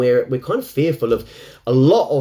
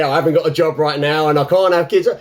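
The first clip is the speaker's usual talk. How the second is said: ordinary talk, nothing marked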